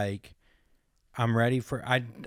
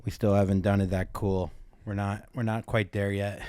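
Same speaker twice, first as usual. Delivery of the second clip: normal talking voice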